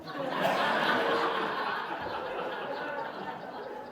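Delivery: long high silent wheezing laugh; breathless laughter. Real, not acted. Pastor cracking up at his wife's punchline, wheezing laugh. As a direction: let the laugh take over, no voice.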